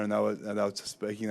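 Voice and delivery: ordinary delivery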